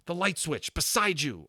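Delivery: high, loud urgent shout; a boy shouting an urgent instruction. A narrator's reading. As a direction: yell the order across the room. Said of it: "Omri shouted".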